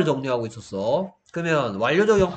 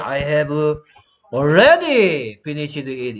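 already를 크게 길게 늘이는 과장된 여유; 느긋하게 뽐내듯 늘여 말하기; 여유 부리는 모범생; 6 dB louder, much slower than usual. Acted. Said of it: "약간 여유 있잖아". (여유 넘치는 모범생처럼 느릿하게)

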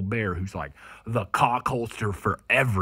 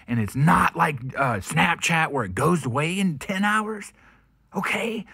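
loud, high yelling at the media; angry shout; 6 semitones higher, 9 dB louder than usual. Real, not acted. Ranting vlogger yelling at the mainstream media, angry shout. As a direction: bark it at the press, fed up.

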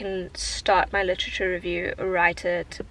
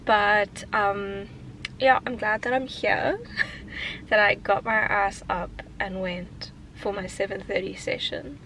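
ordinary talk

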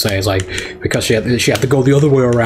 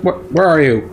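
ordinary talk, nothing marked

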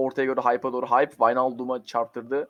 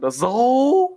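high, loud, rising call of the name; excited shout; 10 semitones higher, 6 dB louder than usual. Real, not acted. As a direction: shout the player's name as he breaks through. Pozisyon anı, oyuncuya bağırış. (heyecanla bağırarak)

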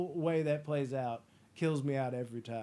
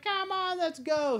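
very high, louder put-on female voice; mock impatient woman; 5 dB louder, slower than usual. Woman in traffic urging, high mock impatient voice. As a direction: whine at the car ahead impatiently. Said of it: "this girl's like".

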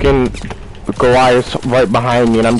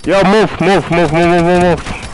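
urgent yelling; 6 semitones higher, slower than usual. Real, not acted. Urging teammate out of way.

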